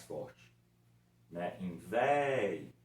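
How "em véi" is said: "véi" very slow, é stressed; slow, strong e. Shown as a demonstration. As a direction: stretch the é. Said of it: "o E é mais forte que o I". ("véi" bem devagar, "é" forte)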